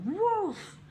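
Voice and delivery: high mock woof; dog bark. Dog answering mum, high mock bark. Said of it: "She's like".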